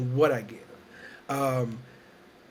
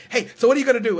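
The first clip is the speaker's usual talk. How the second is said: higher, louder eager ask; eager organizer; 7 semitones higher, 9 dB louder, much faster than usual. Retold or imitated. Organizer pressing for her plans, eager and loud. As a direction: imitate the keen organizer. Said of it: "the person who put it together was like".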